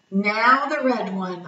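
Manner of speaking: plain reading voice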